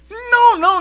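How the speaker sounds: high, loud puppet protest; squeaky puppet no. Acted. Puppet squeals to stop the wrong guess, high and loud. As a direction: cut him off in a squeal.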